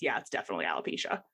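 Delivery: low, quick, offhand diagnosis; matter-of-fact doctor. Retold or imitated. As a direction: rattle it off like a blasé doctor. Dermatologist gives diagnosis.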